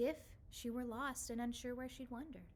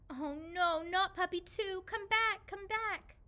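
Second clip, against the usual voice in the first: high, loud cry calling a pet back; desperate calling; 8 semitones higher, 6 dB louder than usual. Acted. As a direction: beg the runaway to come back. Calling after runaway puppy.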